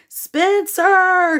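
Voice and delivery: loud high call up the stairs; yelled. A narrator's reading. Mom calling up the stairs, loud yell. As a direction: mom yells her son's name. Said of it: "she yelled at the top of the stairs".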